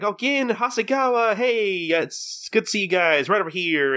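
high sing-out welcome, easing down; gushing hostess. Retold or imitated. Hostess welcomes two familiar customers, gushing. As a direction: imitate an over-delighted hostess greeting.

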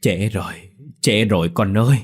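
grieving, pained resignation; bitter sorrow. A narrator's reading. Elder telling Dậu it's too late, sorrowful. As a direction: break it gently, with an aching heart. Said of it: "giọng chua xót".

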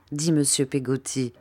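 ordinary delivery

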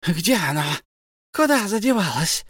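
high, anxious creature cry; searching in panic; frantic Gollum. A narrator's reading. Gollum hunts for his lost ring in panic. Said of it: "услышал Бильбо".

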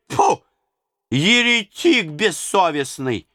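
very high, slow furious spitting; old nurse cursing the tsar; spitting anger. A narrator's reading. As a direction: spit the curse out in a rage. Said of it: "застучала клюкою".

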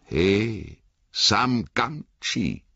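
slow, drawn-out call to oneself; slow self-address. A narrator's reading. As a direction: pull yourself up short, stretching the name. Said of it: "sagte er laut".

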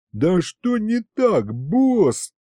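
high, drawn-out wail of protest. A narrator's reading. Demon protests to his boss, mild wail. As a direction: wail it slowly, pitched well up. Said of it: "завопил он".

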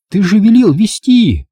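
high, loud, slow protest; indignant Angela. A narrator's reading. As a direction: protest that he told her to lead. (Анжела возмущённо, громко)

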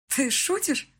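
high, light, incredulous question; amused, laughing question. A narrator's reading. Bride asking groom about his bouquet, amused. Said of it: "со смехом спросила я его".

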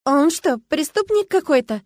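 high, loud, thrilled question; breathless awe. A narrator's reading. Girl asks about the former statue, with breathless curiosity. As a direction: ask with an awed breath in it. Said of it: "с придыханием спросила я".

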